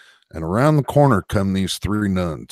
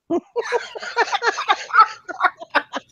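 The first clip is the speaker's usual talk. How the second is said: high cackling laugh. Real, not acted. Joke teller cracking up at his own punchline, high cackle.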